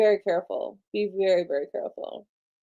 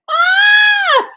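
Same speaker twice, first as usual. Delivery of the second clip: loud, very high shriek of success; excited scream; 10 dB louder than usual. Real, not acted. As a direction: squeal out loud in triumph. Email has finally arrived.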